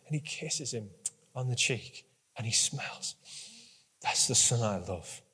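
quiet loving narration; hushed and tender. Acted.

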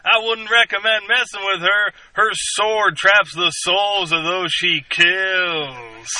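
slow, flat, stiff line reading of the Katana intro; Rick Flag impression. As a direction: deliver it like a wooden action hero reading cue cards. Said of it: "your Rick Flag quote".

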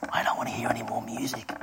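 quick, hushed whisper; whispered complaint. Retold or imitated. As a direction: whisper it like a secret grumble. Old lady confides her complaint.

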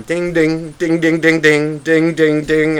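mimicking the coin counter; coin machine dings. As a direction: ring it like a machine.

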